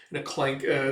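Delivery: ordinary delivery, nothing marked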